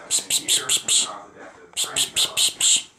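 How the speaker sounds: soft unvoiced psh-psh to lure puppies; whispered psh calls. Real, not acted. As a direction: hiss softly to call them over. Calling puppies with noise.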